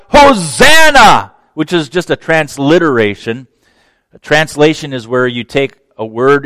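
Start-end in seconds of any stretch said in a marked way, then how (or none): 0.1-1.3 high, loud, slow crowd shout of Hosanna; drawn-out ringing hosanna from the crowd. Retold or imitated. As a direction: call it out across the crowd. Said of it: "They're shouting out".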